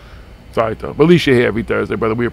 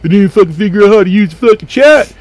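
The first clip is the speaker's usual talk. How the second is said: snide know-it-all mimicry, louder at the end; mocking a smart-ass; 5 semitones higher, 6 dB louder than usual. Host mocking a viewer who thinks they're new, snide mimicry. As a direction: sneer it like a smug commenter. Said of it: "some smart ass was like".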